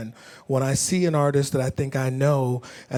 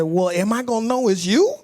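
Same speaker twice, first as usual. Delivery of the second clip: higher-pitched imitation of his daughter; concerned daughter; 7 semitones higher, 3 dB louder than usual. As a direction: ask like a doubtful child. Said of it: "She said".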